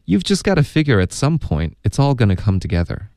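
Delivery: fluent, linked read-through at everyday pace; natural-speed model. Shown as a demonstration. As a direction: run the whole sentence together without stopping. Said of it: "那还要请老师多读几遍".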